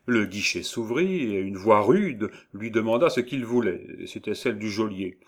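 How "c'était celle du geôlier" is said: plain reading voice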